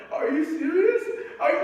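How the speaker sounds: high, teary mock whine of a scared kid; son fighting back tears. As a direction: squeak it out like a boy about to cry. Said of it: "he's fighting back tears".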